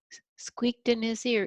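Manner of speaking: ordinary delivery, nothing marked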